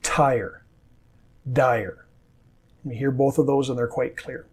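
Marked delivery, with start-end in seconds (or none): 0.0-0.6 Tire with a crisp aspirated T at the start; Tire, T netta e soffiata all'inizio; clear initial T. Shown as a demonstration. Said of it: "Both t and d are clear in word initial position". 1.5-2.1 dire with a clear voiced D at the start; dire, D sonora e chiara all'inizio; clear initial D. Shown as a demonstration. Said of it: "Both t and d are clear in word initial position".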